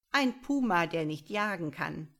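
gleeful taunt; mocking. A narrator's reading. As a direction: jeer at a rival's weakness. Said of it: "rief der Quetzal spöttisch".

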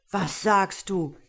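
loud, slow, outraged question; incensed shepherd; indignant. A narrator's reading. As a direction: challenge it angrily, drawing out the words. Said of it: "rief der Mann erbost".

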